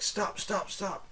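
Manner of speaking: high-pitched, repeated plea to halt; acted high, urgent interruption without shouting; urgent halting call. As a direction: imitate someone urgently stopping people. Acting man halting the pallbearers.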